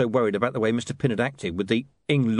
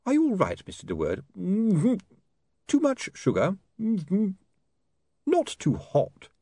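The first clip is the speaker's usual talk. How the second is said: lightly voiced hovel-dweller; a hint of a fussy old host; old tramp voice; 10 semitones higher than usual. Voices the crew member.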